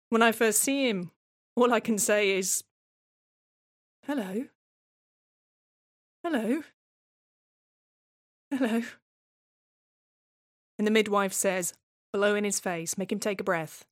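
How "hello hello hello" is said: put-on high little hello to a new baby; tender greeting. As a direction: coax a newborn gently, lifting each word. Parent greeting newborn son.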